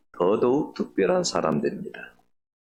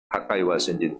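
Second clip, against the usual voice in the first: ordinary delivery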